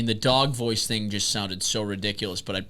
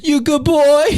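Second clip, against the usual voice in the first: dog voice; 9 dB louder, slower than usual. Pretend. Dog voice bit continues.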